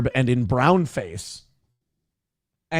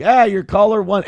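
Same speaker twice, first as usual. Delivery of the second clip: radio DJ voice; 5 semitones higher, 5 dB louder than usual. Retold or imitated. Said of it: "we would be like".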